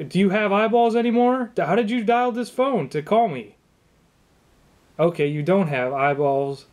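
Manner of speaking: usual speaking voice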